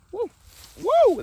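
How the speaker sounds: high whooping yelp at the sour taste; sour woo shout. Real, not acted. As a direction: yelp it out, shocked by sourness. Tasting very sour berry.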